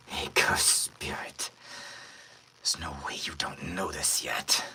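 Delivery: low whispered villain voice; hushed, breathy villain; quiet breathy villain. Shown as a demonstration. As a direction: breathe the threat out softly. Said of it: "real quiet villain voice that I do, or like that, like, breathy villain voice".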